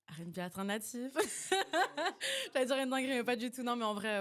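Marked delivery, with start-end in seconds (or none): 1.2-2.5 high, loud giggle; embarrassed high laugh; burst of laughter. Real, not acted. Guest laughs at her own answer. 2.5-4.2 amused. Real, not acted. Guest laughs off her answer.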